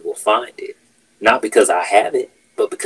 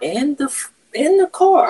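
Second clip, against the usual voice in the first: much higher, squeaky disbelieving repeat; stunned disbelief; slower than usual. Retold or imitated. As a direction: say it like you can't believe it, pitched way up. Retelling keys found in car.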